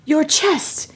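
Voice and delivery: halting, high-pitched disbelief; astonished, broken-up delivery with rising pitch; sputtering disbelief. Acted. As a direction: stammer it out in pieces, stunned. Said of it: "he sputtered in disbelief".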